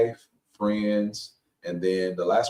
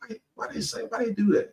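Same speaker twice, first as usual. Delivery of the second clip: exasperated inner question; 13 semitones higher, faster than usual. Retold or imitated. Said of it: "you like".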